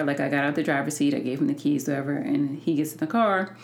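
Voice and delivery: ordinary talk, nothing marked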